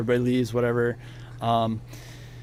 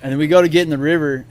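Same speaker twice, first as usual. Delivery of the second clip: usual speaking voice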